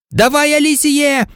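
high, urging commentator call; fan's eager shout of encouragement; excited cheering. A narrator's reading. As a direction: cheer the player on from the stands. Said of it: "перервав напружену тишу Лі Джордан".

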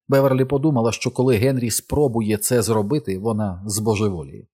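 ordinary delivery

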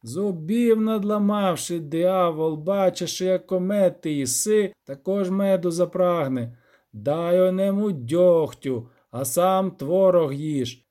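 lightly sing-song granny reader; old granny reading aloud. Acted. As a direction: recite an old book with drawn-out phrases.

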